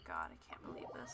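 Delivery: whispered disbelief. Real, not acted. Streamer muttering to herself at the screen, whispered. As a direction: breathe it out in disbelief.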